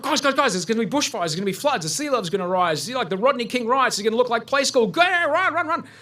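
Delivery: high, frantic, half-laughing alarm; imitating his own frantic warning to strangers; panicked warning. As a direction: yell it like the world is ending. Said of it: "grabbing people and warning them".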